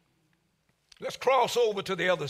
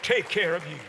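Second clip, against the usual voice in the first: call and response; 3 semitones higher than usual. Shown as a demonstration. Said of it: "Look at somebody, tell them".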